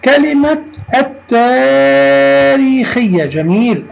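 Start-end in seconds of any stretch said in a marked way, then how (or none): 0.9-3.2 التاريخية high and very drawn out, doubled t, no l; silent lam. Shown as a demonstration. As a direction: say التاريخية as att-taareekhiyya, slowly. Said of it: "كتبنا اللام ولم ننطقها".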